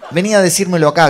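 high, loud mock challenge; retold shout. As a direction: yell a dare across the room. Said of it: "gritó el otro". (desafío imitado a gritos)

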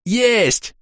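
high, triumphant cricket; gleeful chirped exclamation; cricket's cry. A narrator's reading. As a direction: cry out in a tiny excited voice. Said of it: "закричал сверчок".

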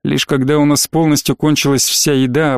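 plain reading voice

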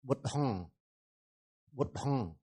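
"Bút hăng" with the final sound humming in the nose; nasal vibration. Shown as a demonstration. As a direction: hold the end of "hăng" softly as a nasal buzz. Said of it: "M chấm dưới sẽ đọc là rung lỗ mũi". (đọc "Bút hăng", rung lỗ mũi ở âm cuối)

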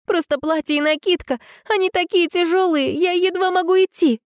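high, tearful pleading voice; a bride near tears. A narrator's reading. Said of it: "всхлипнула Алекс".

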